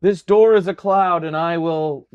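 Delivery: loud, high, euphoric declaration; hammed-up blissful delusion; grandiose dreamer. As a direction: proclaim it as if about to soar. Dreamer charges at the door.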